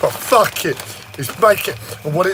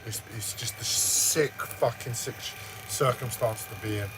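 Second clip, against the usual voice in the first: very quiet, low and depressed; real hushed despair; shut-up, subdued voice; 6 semitones lower, 18 dB quieter than usual. Real, not acted. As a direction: murmur it low, drained after an outburst. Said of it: "I've shut up".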